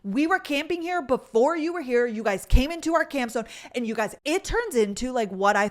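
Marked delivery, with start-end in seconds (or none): 0.0-3.5 higher, louder imitated protest; heated, raised-pitch retelling of a complaint; retold angry camper. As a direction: act out a camper arguing his claim. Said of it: "dropped the F-bomb and was like".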